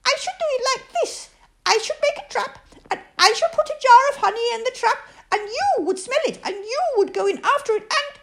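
high squeaky voice, quick, giggling through it; a tiny pig laughing at his own clever plan; giggly Piglet. Acted. Piglet explains the honey trap to Pooh, high and giggly.

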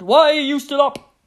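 high, loud trumpeted demand; trumpeting mummy elephant. A narrator's reading. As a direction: blare it out like an elephant mother. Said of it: "trumpeted Mummy Elephant".

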